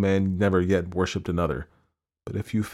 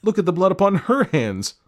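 higher, louder accusing cry; 12 semitones higher, 5 dB louder than usual. A narrator's reading. Ferryman points out the blood to the constable, crying out. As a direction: shout the accusation at her. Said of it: "I cried".

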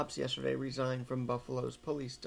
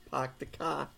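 "Park the car." again with r-less Boston vowels; a Boston "Pahk the cah" a bit softer; Boston accent; 5 dB quieter than usual. Pretend. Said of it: "has a Boston accent".

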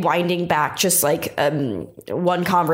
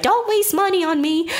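imitating grandma; 11 semitones higher than usual. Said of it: "she's like".

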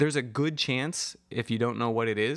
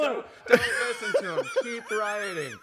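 high-pitched heckler in the crowd; playful put-on rioter call; mock rioter; 11 semitones higher than usual. Playing heckler to the proclamation reader.

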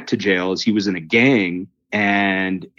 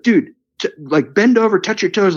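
much higher, breathless urgent instructions; excited; 12 semitones higher than usual. Retold or imitated. Urging the healed man to touch his toes, excited and high. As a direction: push him to test the leg, thrilled. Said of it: "total freak out mode".

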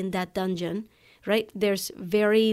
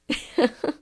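short laugh; 6 semitones higher than usual. Real, not acted. Laughing at the memory.

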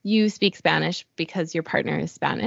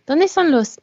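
pitched up and louder, pleading; louder, higher retelling of the shop question; exaggerated louder ask; 9 semitones higher, 6 dB louder than usual. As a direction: push the question out high and loud, like asking a shopkeeper to understand. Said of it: "I'll say".

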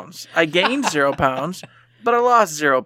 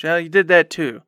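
usual speaking voice

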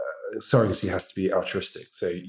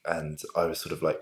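ordinary talk, nothing marked

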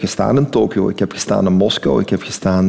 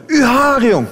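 a much higher call of "Uw haar, jong!", like a mother calling after her son; slower than usual. Retold or imitated. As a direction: call out to her son about his hair, high-pitched. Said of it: "riep ze altijd".

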